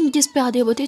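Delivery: ordinary delivery, nothing marked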